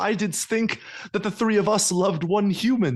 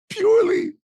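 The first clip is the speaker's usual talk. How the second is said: higher, louder wail of the word; anguished cry; 5 dB louder than usual. Acted. Escalated repeat.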